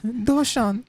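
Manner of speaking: very high imitation of a moved daughter; emotional daughter. Echoing what she says to her dad, high emotional daughter voice. Echoes girl's word to dad.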